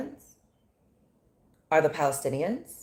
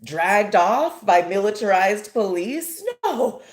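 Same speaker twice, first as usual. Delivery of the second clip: high, loud mock-cheerful employee; hammed-up forced cheer; fake happy; 6 semitones higher, 4 dB louder than usual. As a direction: pretend all is wonderful, sarcastically over-bright. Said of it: "pretend to be happy employees".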